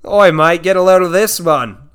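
put-on Australian accent. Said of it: "poor Australian accent".

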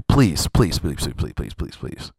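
plead, then let each "please" die away to a breath; fading plea. Acted.

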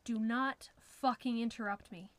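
loud, forceful warning; threatening anger. A narrator's reading. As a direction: threaten with controlled, emphatic anger. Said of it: "His voice was low and threatening".

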